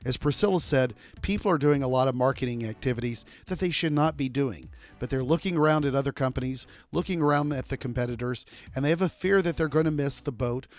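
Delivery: podcast outro read. Acted. Host wrapping up for listeners, polished announcer read. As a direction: sum up the takeaways like a radio host. Host's wrap-up read over music.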